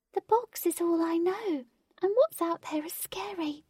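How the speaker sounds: high, small, wary fairy voice; a tiny fairy afraid of the world; high nervous fairy voice; timid fairy. A narrator's reading.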